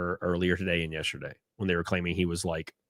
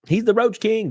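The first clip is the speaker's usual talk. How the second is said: excited; 13 semitones higher, 5 dB louder than usual. Acted. Hyping the Roach King.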